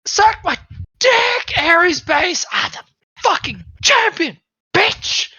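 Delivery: crowing insult at the losers; taunting victory yell. Acted. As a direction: shout it at the whole base. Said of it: "And you're just like".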